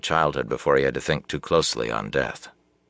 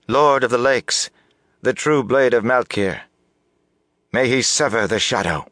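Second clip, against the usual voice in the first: raised, high-pitched oath of fealty; strong, clear announcement; 7 semitones higher, 4 dB louder than usual. A narrator's reading. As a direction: proclaim it clearly to the whole hall. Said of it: "announced in a strong, clear voice".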